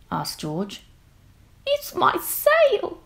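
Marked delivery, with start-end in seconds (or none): 1.7-3.0 very high, slow bleating goat voice; a sad little goat on a boat. Acted. The goat on the boat telling George its trouble, very high and slow. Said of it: "said the goat".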